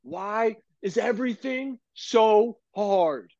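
high, drawn-out wail of despair; exasperated cry. Retold or imitated. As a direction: cry it slowly up to the heavens. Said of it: "look straight up in the air and go".

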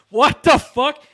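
loud high shocked cry; shocked outburst. Retold or imitated. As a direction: blurt it out stunned. Friend shocked at definition.

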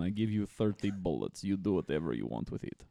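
broken English in a lightly put-on Russian accent; low-key Russian accent, broken grammar; mock Russian accent. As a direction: hand over the guns like a Russian gangster, in a Russian accent. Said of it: "speak English in a Russian accent".

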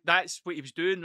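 usual speaking voice

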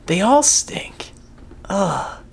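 breathy whispered aside; a slow, voiceless aside. Real, not acted. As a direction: whisper it slowly under the breath.

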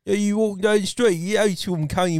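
mumbled mock Cockney, barely understandable; hammed-up Cockney gibberish; Cockney accent. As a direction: garble it in thick Cockney. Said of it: "That's Cockney".

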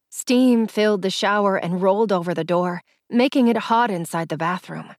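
usual speaking voice

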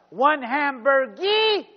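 loud, slow counter call; yelled order. Retold or imitated. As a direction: bellow the order down the line. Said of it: "and he yelled out".

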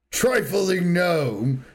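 high, slow, grandiose demon lord boast; booming Jaraxxus. Retold or imitated. Friend quoting a game boss to his co-host, grandiose boom. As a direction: announce yourself like a towering demon lord. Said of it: "I love that fucking voice line".

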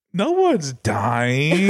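very slow, dragged-out words; slow stoned drawl. Retold or imitated. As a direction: stretch every word out lazily. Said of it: "I was like".

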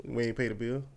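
very soft, low; murmuring about the unpaid light bill; hushed joke. Pretend. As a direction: drop it down low as if in the dark. Joking in the dark.

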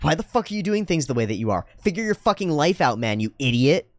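mock a critic laying into someone; harsh criticism. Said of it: "when some of these people criticize".

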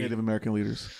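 ordinary talk

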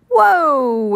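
high, amazed whoa; amazed exclamation. A narrator's reading. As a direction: exclaim in wonder, pitch up and sliding down. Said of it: "exclaimed Shine".

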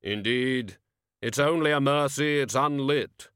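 very slow, booming, grave voice; Death's voice. Acted. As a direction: drag each word out like a tomb.

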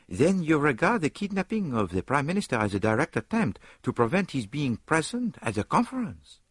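Poirot voice. A narrator's reading. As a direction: weigh the question seriously. Poirot questioning Lord Esther.

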